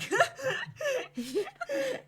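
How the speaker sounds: loud squealing laugh; high laughing fit. Real, not acted. GM cracking up at her own character bit. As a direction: crack up loudly.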